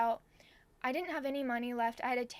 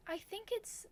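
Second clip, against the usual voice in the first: high, soft imitation of a cashier; unsure cashier; 10 semitones higher, 7 dB quieter than usual. Cashier guesses the address for a customer, unsure. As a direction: guess timidly in a high, small voice.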